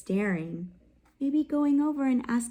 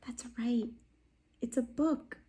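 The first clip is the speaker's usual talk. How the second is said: whisper; 7 dB quieter than usual. Real, not acted. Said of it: "confirms student answer, hushed".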